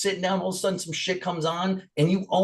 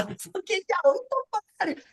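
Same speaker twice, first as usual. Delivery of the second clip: put-on much higher squeaky baby talk; mock baby voice; 3 dB quieter than usual. Priest in church swears at the congregation in baby voice. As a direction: swear sweetly like a toddler. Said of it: "people talking like a baby".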